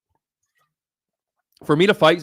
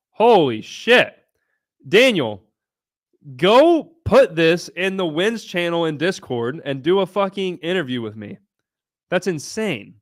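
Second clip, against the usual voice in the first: excited; 6 semitones higher than usual. Real, not acted. Hyped reaction to member's big win.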